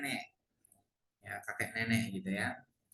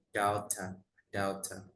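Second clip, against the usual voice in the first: British "daughter" with the r dropped; British pronunciation; 4 semitones lower, 4 dB quieter, slower than usual. Shown as a demonstration. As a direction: let the final r vanish, British-style "daughter". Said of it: "British R-nya agak hilang". ("daughter" logat British, R hilang)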